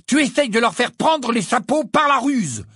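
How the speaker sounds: high, loud angry protest; angry argument. Acted. Ron protests against tricking the elves, angry. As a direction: keep the anger pushing hard.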